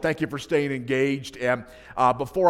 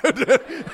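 laughs; 11 semitones higher, 6 dB louder than usual. Real, not acted. Laughing at son's answer.

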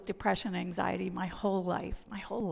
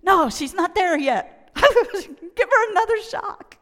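high, loud emergency-room call; urgent medic shout; 10 semitones higher, 12 dB louder than usual. Acted. Preacher playing medic at a resuscitation, urgent shout. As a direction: shout orders over a patient being revived.

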